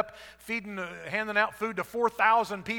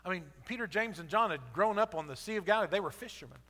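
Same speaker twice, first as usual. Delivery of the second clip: usual speaking voice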